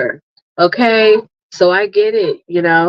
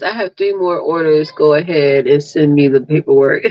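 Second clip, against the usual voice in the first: ordinary talk, nothing marked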